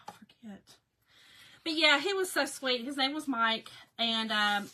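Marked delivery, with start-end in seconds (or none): 0.1-0.6 whispered aside. Real, not acted. Admitting she forgets, aside.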